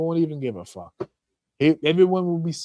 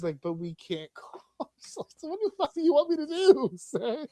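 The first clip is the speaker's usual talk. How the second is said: ordinary delivery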